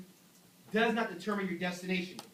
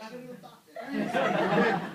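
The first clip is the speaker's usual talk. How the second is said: doubtful self; 4 semitones higher, 6 dB louder than usual. Retold or imitated. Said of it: "and I'm like".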